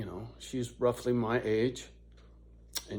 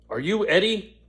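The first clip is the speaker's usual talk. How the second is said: imitating the lady; 7 semitones higher, 5 dB louder than usual. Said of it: "This lady says".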